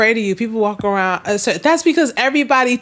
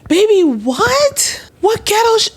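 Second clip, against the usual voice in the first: usual speaking voice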